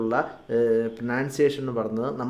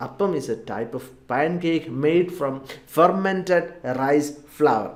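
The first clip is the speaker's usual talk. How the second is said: very slow, word by word; slow model sentence; much slower than usual. Shown as a demonstration. Teacher explaining appam for learners, very slow. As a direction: lay it out slowly for learners. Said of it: "നമുക്ക് ഒന്നുകൂടെ പറയാം".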